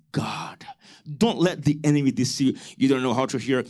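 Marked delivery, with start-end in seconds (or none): none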